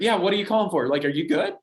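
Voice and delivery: worried mom. Retold or imitated. Basketball mom answering Ethan's call, alarmed and worried. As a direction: sound alarmed that he called. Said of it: "like, worried about me".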